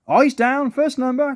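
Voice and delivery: high, projected bingo caller's cry; calling out. A narrator's reading. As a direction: call the game to the hall. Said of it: "called a voice".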